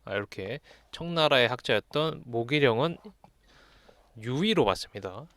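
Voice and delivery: usual speaking voice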